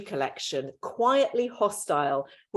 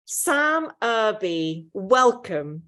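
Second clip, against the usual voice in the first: announcer welcome; 4 dB louder, much slower than usual. Acted. Host formally welcomes the guest.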